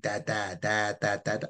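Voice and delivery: put-on monotone baby babble, syllable after syllable; string of even, unemphatic baby syllables; babbling young child. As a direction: lightly imitate a baby's first babbling. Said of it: "when kids start learning language".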